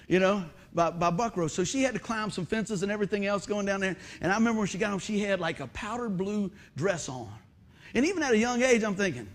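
ordinary talk

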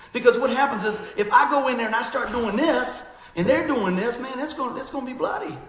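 fired-up preaching. Real, not acted. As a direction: push it with fire. Preacher building intensity.

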